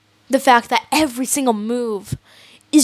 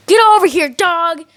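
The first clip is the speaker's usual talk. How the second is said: higher, louder mock yell at the dog; panicked yell; 6 semitones higher, 3 dB louder than usual. Panicked owner yelling at the dog, mock yell. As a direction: snap the order in a hammed-up panic. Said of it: "they're so panicked that they just yell".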